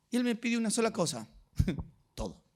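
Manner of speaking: ordinary talk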